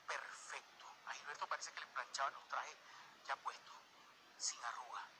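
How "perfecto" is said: ordinary talk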